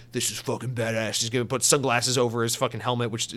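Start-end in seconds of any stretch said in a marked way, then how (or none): 0.1-1.2 lightly voiced tough action hero; Master Chief. Host imagines Master Chief's reaction, lightly voiced tough hero. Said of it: "Master Chief's gonna be like".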